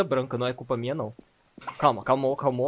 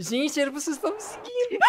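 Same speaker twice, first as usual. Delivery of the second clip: scared whining; 11 semitones higher than usual. Real, not acted. Left alone, chased by monster.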